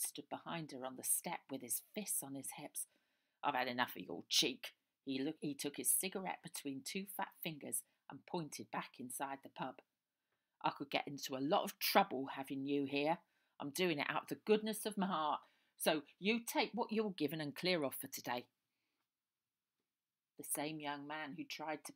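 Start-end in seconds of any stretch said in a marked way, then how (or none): 3.4-4.7 gruff landlord. Acted. Landlord scolds girl on step. 10.6-18.5 stern landlord. Acted. Landlord throwing girl out.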